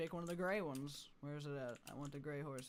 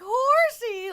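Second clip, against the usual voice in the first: high, loud cartoonish squeal; cartoon yell; 18 dB louder than usual. Shown as a demonstration. Streamer demonstrating her exaggerated yelling, squealing. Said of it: "exaggerated and cartoon sounding and, and yelling".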